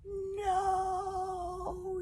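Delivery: long, high desperate cry; boy yelling to stop the arrows. A narrator's reading. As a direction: scream it out. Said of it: "yelled Hiccup".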